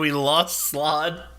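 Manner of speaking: ordinary talk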